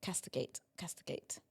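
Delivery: soft whispered practice of castigate; muttered practice. Real, not acted. Practising word quietly.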